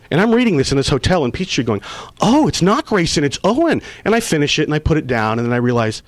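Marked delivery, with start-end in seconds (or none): 2.2-3.8 the penny drops mid-sentence; surprised realization. Retold or imitated. Actor reading the finale script, realizing it is his character, surprised.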